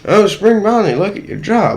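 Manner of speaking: high, loud mock dentist scolding; fussy dentist. The fnaf dentist scolding Spring Bonnie about his jaw, high and loud. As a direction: play a fussy cartoon dentist tutting at a patient. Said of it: "the fnaf dentist to go".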